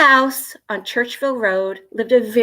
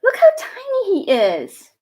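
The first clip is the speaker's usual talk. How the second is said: high, tender cooing; cooing mommy; 11 semitones higher than usual. A narrator's reading. Mom cooing over the tiny puppy, high and tender. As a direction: coo over the little puppy. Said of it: "said Mommy".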